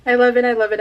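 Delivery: ordinary talk, nothing marked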